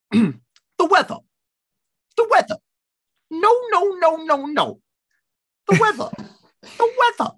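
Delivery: very high, strained sing-song cry of the weather, with a laugh; Jesse Lingard impression. Podcaster mimics footballer-poet Jesse Lingard's weather rant, very high. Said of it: "It's a great impression".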